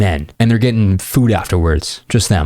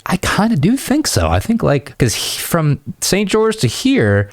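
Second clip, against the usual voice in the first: playful insistence; 10 semitones higher than usual. Retold or imitated. Teasing insistence, high.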